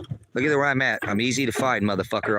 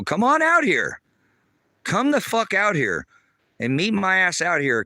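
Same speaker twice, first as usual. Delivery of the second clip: challenging holler; 7 semitones higher, 4 dB louder than usual. Real, not acted. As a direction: dare them, pitch leaping up on each call. Daring a threatener to come out.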